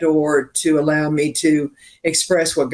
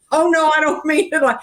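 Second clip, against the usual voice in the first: high-pitched mock backpedalling; imitated flustered apology; 11 semitones higher than usual. Imitating a barista apologising to the songwriter, flustered. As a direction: imitate someone hurrying to take it back.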